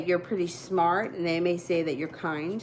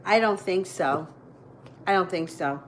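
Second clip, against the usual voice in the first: normal talking voice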